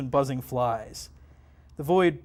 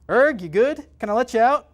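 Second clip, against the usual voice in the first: high, loud nasal call; 9 semitones higher, 8 dB louder than usual. Acted. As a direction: call out high through the nose. Tim calls to demon.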